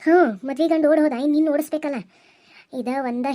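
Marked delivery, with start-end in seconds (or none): 0.0-2.0 loud, higher sarcastic snap back; angry retort. Acted. Aunt mocking Kamalavva's question with an angry retort. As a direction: throw her own words back at her, fuming.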